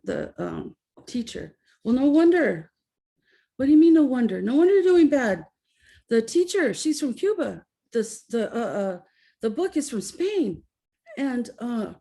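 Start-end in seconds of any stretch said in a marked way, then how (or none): 1.8-2.7 teacher's retort. Retold or imitated. Retold dad arguing with teacher. 3.6-4.4 higher and louder, indignant challenge; indignant dad. Retold or imitated. Dad confronting the Spanish teacher, indignant and louder. 4.4-10.6 explaining teacher. Retold or imitated.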